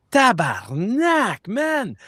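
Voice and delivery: high, startled Québécois curse, drawn out; French Canadian swear. Retold or imitated. Cop freaks out at the craft, in a high startled French Canadian curse. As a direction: blurt it like a shocked Québécois cop. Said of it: "in French Canadian".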